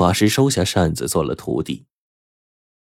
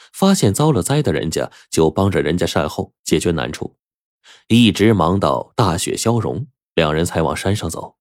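usual speaking voice

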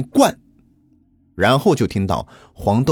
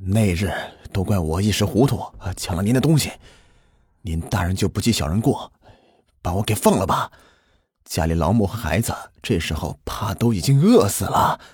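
weak, low, soft plea; acted feeble, downcast begging; begging for mercy; 5 semitones lower, 4 dB quieter than usual. A narrator's reading. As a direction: plead like a starved prisoner. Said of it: "他只得求饶".

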